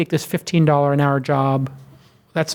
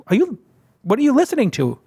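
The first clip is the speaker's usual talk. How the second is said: pitched higher, playing dumb; feigned innocent question; 8 semitones higher than usual. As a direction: ask as if you don't know him at all. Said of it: "she goes".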